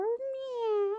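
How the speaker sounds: a sweet little meow; kitten meow. Acted. Kitten answers the dinosaur.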